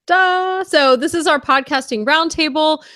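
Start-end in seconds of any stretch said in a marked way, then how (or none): none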